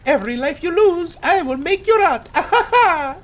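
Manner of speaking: very high squeaky bad Mario voice; Mario impression. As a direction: do a terrible cartoon Mario, cursing. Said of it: "I asked Mario".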